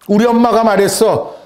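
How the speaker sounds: put-on high, loud child's retort; insistent little girl. Girl answers the doubting boy, insistent. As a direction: insist on it like a trusting kid. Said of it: "이 여자아이가 뭐라고 대답하냐면요".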